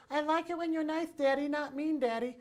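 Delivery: put-on high-pitched three-year-old talking to daddy; little boy's voice. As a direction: speak up high like a small child. Young son's words acted out.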